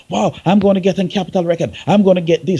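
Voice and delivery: quick, high, eager voice of the hopeful artist; naive excitement. Retold or imitated. The hopeful young artist gushing about signing, excited. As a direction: gush like a new artist dreaming of a deal.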